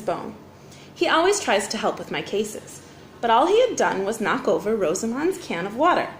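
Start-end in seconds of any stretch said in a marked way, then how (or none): none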